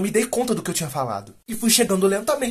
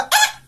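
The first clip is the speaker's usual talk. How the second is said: sudden high-pitched mock scream; high yelp. Boy being pulled out by the sea, high mock yelp. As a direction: squeal as if suddenly swept off.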